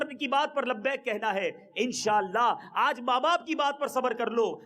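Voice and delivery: ordinary talk, nothing marked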